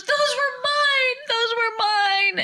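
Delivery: high, slow, wailing daughter; sobbing daughter. Retold or imitated. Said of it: "she just was sobbing".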